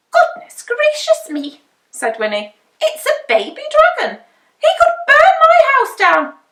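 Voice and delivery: high, loud flustered witch voice; fussing over the baby dragon in alarm. A narrator's reading.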